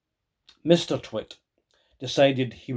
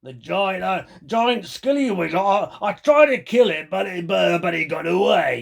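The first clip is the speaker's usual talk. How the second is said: put-on sly old man; 6 semitones higher, 3 dB louder than usual. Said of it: "Mister Twit said".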